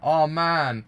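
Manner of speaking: ordinary talk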